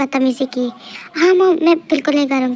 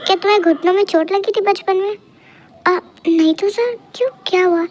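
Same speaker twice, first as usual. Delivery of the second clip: ordinary delivery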